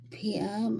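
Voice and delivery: normal talking voice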